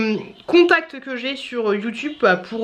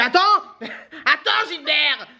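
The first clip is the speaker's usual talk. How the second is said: high, comic put-on voice; jokey retold "wait"; mock call; 5 semitones higher, much slower than usual. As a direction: call out in jest, breaking into laughter. Acted reaction, calling out in jest.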